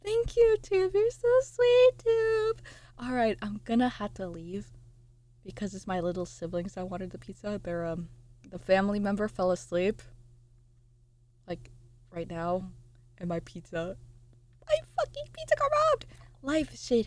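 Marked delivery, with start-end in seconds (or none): 0.1-2.6 cute high thanks. Real, not acted. Thanking a viewer gushingly. 14.7-16.1 mock outraged whine. Complaining pizza stolen.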